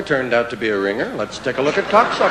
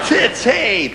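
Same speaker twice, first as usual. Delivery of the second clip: ordinary talk, nothing marked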